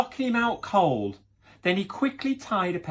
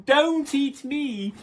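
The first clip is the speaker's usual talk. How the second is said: put-on pleading child; 11 semitones higher, 3 dB louder, much slower than usual. Little Red pleading.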